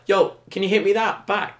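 high-pitched, teasing call-out; acted-out wind-up; mock cheeky request. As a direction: needle him with fake-innocent asking. Retold taunting of sulking classmate.

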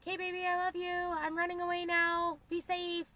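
squeaky high, hurried goodbye; high squeaky panic voice; high-pitched panicky voice. Pretend. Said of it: "this is what you'd hear".

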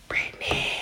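eerie whispered name; upiorny głos. Retold or imitated. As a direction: whisper it like a ghostly voice calling. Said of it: "I just heard this voice say".

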